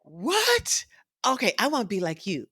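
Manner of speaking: high "What?", then fast eager voice; shock, then eager conviction; stunned girls. Retold or imitated. Girls hearing the scientist's salary, high shock then eager. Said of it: "They're like".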